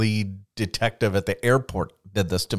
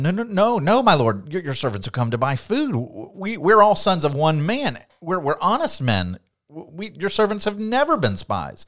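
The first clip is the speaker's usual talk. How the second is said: higher, rushed panicky denial; pleading brothers; 6 semitones higher than usual. Retold or imitated. Brothers protesting to Joseph, higher and panicky. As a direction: protest your innocence, flustered.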